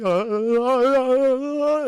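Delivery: loud mock frightened wail; high, quavering cowardly moans; scared whimpering. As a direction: whimper like a terrified cartoon dog. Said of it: "He gets very scared".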